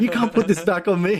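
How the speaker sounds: high, loud protest; mock indignant retort. Acting out a bride blamed for the wedding, mock indignant.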